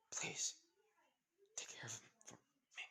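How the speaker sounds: fading into a whisper; dying plea. Acted. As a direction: breathe out the last wish with no strength left. Mother's dying last words.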